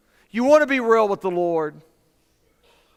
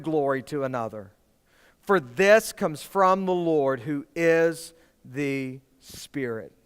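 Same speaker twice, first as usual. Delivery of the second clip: slow, hushed scripture reading; 5 semitones lower, slower than usual. Acted. Pastor reads the verse aloud to the congregation, slow and hushed. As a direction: let each phrase settle slowly.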